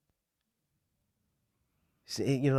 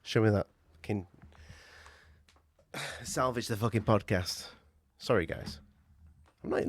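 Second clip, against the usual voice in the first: ordinary talk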